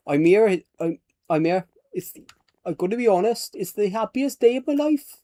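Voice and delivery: a mild grumpy Welsh voice about his happiest day; grumpy Welsh accent. Retold or imitated. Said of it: "they still sound pissed off".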